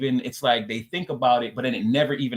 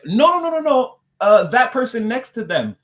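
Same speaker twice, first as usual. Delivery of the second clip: much higher, louder no, no, no; protector refusing; 10 semitones higher, 6 dB louder than usual. Acted. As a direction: protector swatting the idea away, high and loud. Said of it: "and it's like".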